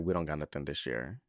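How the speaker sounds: low, soft, indifferent clerk; store clerk. Retold or imitated. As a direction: imitate an unhelpful shop worker, voice dropped low. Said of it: "they're like".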